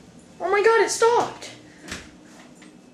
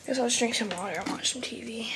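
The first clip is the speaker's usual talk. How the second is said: whispering; 6 semitones lower, 5 dB quieter than usual. Acted. Bored muttering to himself.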